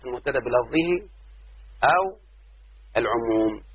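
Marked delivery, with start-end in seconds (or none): none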